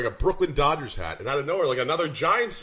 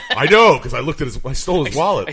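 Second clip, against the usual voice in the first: loud, laughing punchline; real laughter through the words; 9 dB louder than usual. Real, not acted. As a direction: crack up while delivering the joke. Joking, laughter on the lines.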